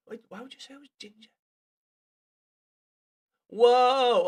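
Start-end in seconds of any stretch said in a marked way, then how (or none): 3.5-4.3 surprised. Real, not acted. Sudden exclamation at screen.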